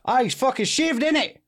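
high, frantic mock Cockney; hammed-up Cockney panic; Cockney caller. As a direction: report an emergency in agitated, high Cockney. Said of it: "is Cockney".

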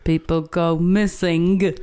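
slow, drawn-out film-star impression; hammed-up, slow Southern-style drawl; bad Tom Hanks. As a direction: do a bad impression, stretching every word. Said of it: "my bad Tom Hanks impression".

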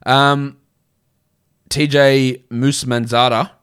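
normal talking voice

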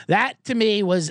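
normal talking voice

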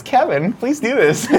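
high-pitched mock plea to Kevin; mock pleading. Host imitating himself begging Kevin to take over, mock pleading. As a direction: beg him in a high, put-on voice. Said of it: "I'll be like this".

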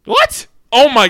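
a sudden high-pitched "What?" in total disbelief; shocked disbelief. Real, not acted. Reacting to a friend's terrible guess, shocked high yelp. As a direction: yelp it out, stunned by the worst guess.

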